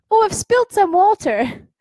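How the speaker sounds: high, loud quoted voice; quoting a child. Retold or imitated. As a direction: pitch it way up and loud for her line. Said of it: "And she said".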